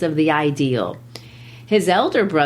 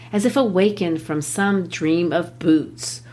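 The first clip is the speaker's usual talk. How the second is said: ordinary talk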